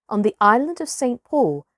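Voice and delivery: ordinary delivery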